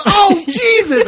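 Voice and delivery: wife recoiling from a fart, hammed up through laughter; laughing mock disgust. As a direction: cry out in mock disgust while cracking up. Wife disgusted at farting husband.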